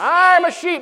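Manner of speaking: like you mean it. Shown as a demonstration. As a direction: declare it like you truly mean it. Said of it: "Now say it like you mean it".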